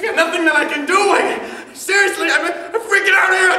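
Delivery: panicked shout. Acted. Panicking about his future.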